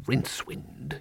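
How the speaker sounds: hushed, confidential whisper. Acted. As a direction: lean in and whisper. Confidential aside.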